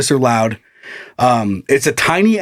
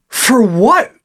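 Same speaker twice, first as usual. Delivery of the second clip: high, loud disbelieving for what; sharp baffled retort, pitched up; stunned and incredulous; 6 semitones higher, 3 dB louder than usual. Retold or imitated.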